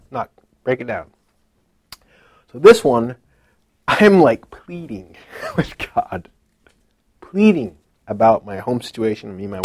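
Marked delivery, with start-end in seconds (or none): none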